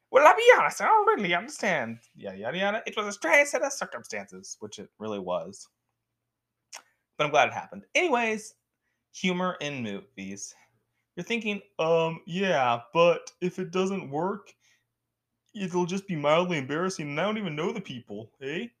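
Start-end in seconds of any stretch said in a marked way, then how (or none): 0.1-4.6 much higher, louder cartoon-villain quote; Doctor Doofenshmirtz impression. Vlogger quoting a cartoon villain to viewers, high and loud. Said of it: "in the words of Doctor Doofenshmirtz". 11.8-18.7 put-on imagined viewer in a mild Canadian accent. Imagined viewer doubting humor, in a light Canadian accent. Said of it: "that person sounded Canadian".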